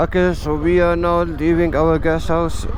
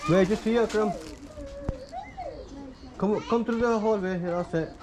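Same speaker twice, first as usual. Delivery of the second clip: calling out; 10 semitones higher, 10 dB quieter than usual. Real, not acted. Guiding someone through hallway.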